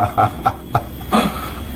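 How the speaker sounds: short, soft mocking chuckle; brief quiet burst of laughter. Real, not acted. Commentator amused by the recording's wording, mocking laugh.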